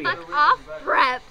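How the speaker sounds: loud mock-furious snap; angry author's rant. Reading the author's note as an angry teen, loud. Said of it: "she's just angry".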